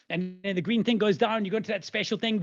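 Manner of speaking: ordinary talk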